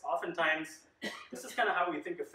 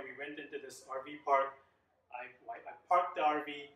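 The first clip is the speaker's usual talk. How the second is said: normal talking voice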